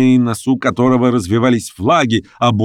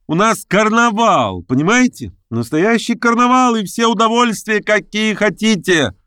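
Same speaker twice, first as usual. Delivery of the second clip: excited carnival announcement; 12 semitones higher than usual. Acted. Masked woman announces the carnival.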